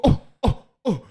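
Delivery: high gasping coughs; mock gasping kid; imitated child gasping. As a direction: gasp like a child who can breathe again. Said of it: "A criança ficou".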